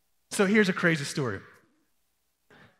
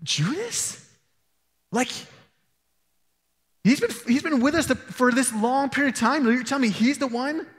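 higher, rising in disbelief; incredulous disciple; 4 semitones higher than usual. Retold or imitated. A disciple doubting Judas could be the one, incredulous. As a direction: ask it as if you can't believe it. Some of them are like.

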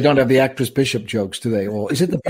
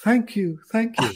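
mock gracious waving lady; 8 semitones higher, slower than usual.

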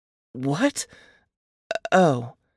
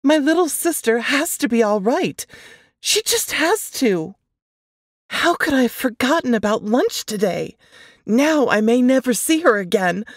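higher, tense and worried; anxious panic; 8 semitones higher than usual. Acted. Narrator fearing for her missing sister, higher and tense. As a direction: fear rising for her sister.

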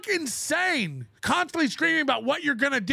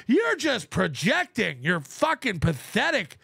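ordinary delivery

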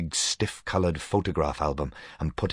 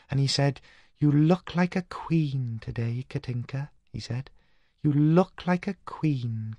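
put-on dreamy young Katinka; clear new voice; 9 semitones higher than usual. A narrator's reading. Dead sister Katinka describes her hat, clear and high. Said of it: "the voice".

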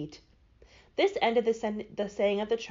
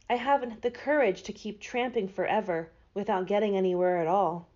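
ordinary delivery, nothing marked

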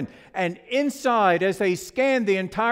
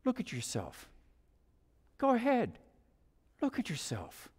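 gentle invitation; 11 dB quieter than usual. Acted. Master handing the teacup a mirror, gently coaxing. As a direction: coax softly, leaving space between phrases.

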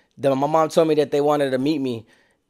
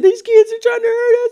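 much higher, louder mock sobbing whine; mock crying whine; 6 dB louder than usual. Carjackers telling Taco Bell staff about the kids, higher mock whine. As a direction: play grown men whining like victims. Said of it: "And they just said".

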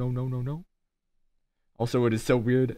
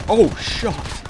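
oh shucks, pitched up and loud; loud high oh shucks; 8 semitones higher, 7 dB louder than usual. Real, not acted. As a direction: yelp the oh shucks. Catchphrase louder, higher.

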